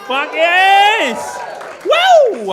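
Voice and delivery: loud, high celebratory whoop; hammed-up cheer. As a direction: whoop like a crowd-pleaser. Celebrating his marriage.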